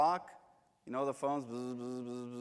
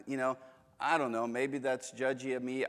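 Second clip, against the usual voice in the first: usual speaking voice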